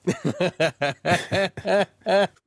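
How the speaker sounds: long, high burst of laughter; big laugh. Real, not acted. Host cracking up at co-host's correction, hearty laughter. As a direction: crack up hard, laughing out loud.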